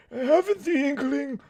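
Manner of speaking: high, slow hammed-up voice; put-on silly voice. Baffled character with no inkling, in a high put-on voice. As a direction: ham it up high and drawn out. More put-on voice, then stops.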